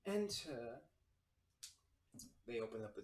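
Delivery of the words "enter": higher-pitched feminine tiefling voice; soft feminine voice. Acted. Tiefling host answers a knock from her office, soft and feminine. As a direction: call out softly as an elegant woman. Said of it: "you hear this soft feminine voice".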